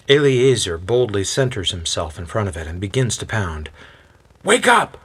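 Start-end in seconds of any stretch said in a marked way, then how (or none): 4.4-5.0 high, loud shout; pounding-on-the-door wake-up yell. A narrator's reading. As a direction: yell it up high to rouse someone indoors. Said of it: "he yells out".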